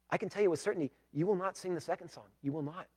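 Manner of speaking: ordinary talk, nothing marked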